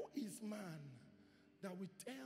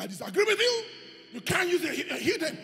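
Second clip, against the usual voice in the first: loud, higher indignant protest; mock objection; 9 semitones higher, 17 dB louder than usual. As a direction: protest like a stubborn believer.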